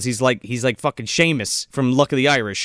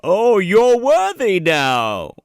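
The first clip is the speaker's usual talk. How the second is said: slow, louder, grand mock Thor voice; mock godly approval; 4 semitones higher, 5 dB louder, much slower than usual. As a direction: boom it out slowly, hammed up.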